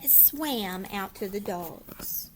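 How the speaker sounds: ordinary delivery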